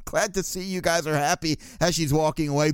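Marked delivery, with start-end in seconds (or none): 0.0-1.6 brushing them off. Retold or imitated. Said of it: "Pearlman was like".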